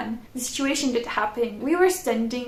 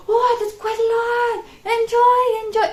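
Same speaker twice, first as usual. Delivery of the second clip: high, eager mock question; excited question; 10 semitones higher, 6 dB louder than usual. Stranger excitedly asks the visitor, high imitated eagerness. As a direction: ask it like an overexcited stranger.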